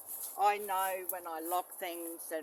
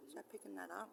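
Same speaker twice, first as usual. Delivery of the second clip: whisper; 14 dB quieter than usual. Real, not acted. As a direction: whisper to yourself. Peering at the find.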